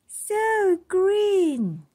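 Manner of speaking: put-on high, wondering child's voice; awed little girl. A narrator's reading. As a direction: breathe it out in wonder. Iris marvels at tree.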